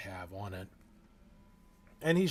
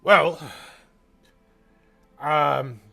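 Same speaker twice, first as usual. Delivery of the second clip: loud, musing dwarf; booming dwarf; 5 semitones higher, 14 dB louder than usual. Acted. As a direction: ponder it out loud. Dwarf considers.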